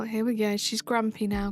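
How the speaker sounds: ordinary talk, nothing marked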